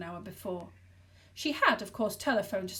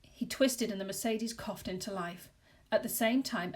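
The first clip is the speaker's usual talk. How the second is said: usual speaking voice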